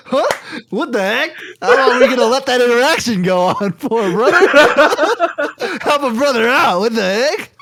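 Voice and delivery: high, loud exasperated plea. Retold or imitated. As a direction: throw it at the guard, laughing. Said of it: "I was like".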